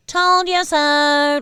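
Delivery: loud, high, drawn-out singsong; annoying friend gloating. Pretend. Podcaster gloating over predictions like an annoying friend, singsong. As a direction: stretch it out to needle them. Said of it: "like your other annoying friend".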